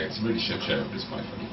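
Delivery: sneaky whispered aside; muttered aside. Retold or imitated. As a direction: slip it to the American under your breath. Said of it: "I'd say".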